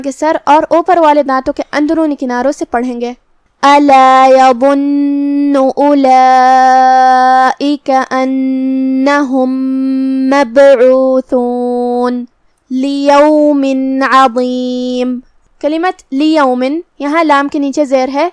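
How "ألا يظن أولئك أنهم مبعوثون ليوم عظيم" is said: slow, measured tajweed chant; Quran recitation. Shown as a demonstration. Teacher recites the verse for students, slow tajweed chant. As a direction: recite with each word drawn out. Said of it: "teacher recites the verse".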